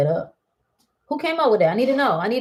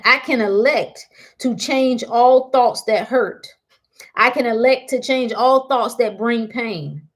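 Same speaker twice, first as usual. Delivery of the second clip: slow, louder recital of an affirmation; 3 semitones higher, 4 dB louder, slower than usual. Acted. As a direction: say it like a creed you mean, slowly.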